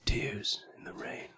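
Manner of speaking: slow, hushed whisper of the famous line; reverent whisper. Retold or imitated. Host whispering Roy Batty's line in tribute. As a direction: breathe it out softly, in reverence.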